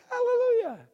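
hammed-up rejoicing; joyful hallelujah. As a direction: celebrate the end of diapers. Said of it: "I was like".

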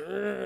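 ordinary talk, nothing marked

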